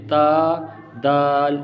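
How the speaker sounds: تا and دال said thin and light; light, drawn-out تا and دال; thin letters. Shown as a demonstration. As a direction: hold تا and دال long without thickening. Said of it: "باریک".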